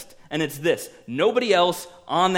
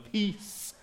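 soft, higher mellow voice; hushed, easygoing mock hippie; laid-back peacenik; 5 semitones higher, 7 dB quieter, slower than usual. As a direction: play a harmless chilled-out guy, quietly. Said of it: "walking around just going".

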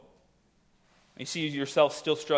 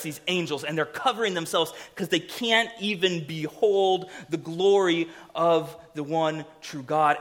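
usual speaking voice

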